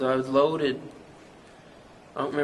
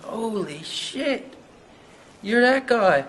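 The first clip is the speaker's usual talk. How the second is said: slow awed gasp rising to a loud, high cry; starstruck surprise; 6 semitones higher, 3 dB louder, slower than usual. Retold or imitated. Meeting the band guy, slow awe then a loud cry. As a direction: drag out holy shit, then blurt the rest. Recognizes the Defense Force guy.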